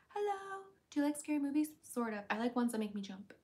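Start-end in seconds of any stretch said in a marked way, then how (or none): 0.1-0.6 breathy high greeting; coy high anime hello; high cute anime girl. Pretend. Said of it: "Hentai voice is better".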